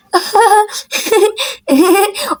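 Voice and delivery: put-on a burst of girlish giggles; giggling laugh. Girl laughing at friend turned into a ghost, giggling. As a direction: laugh at the friend's messy makeup.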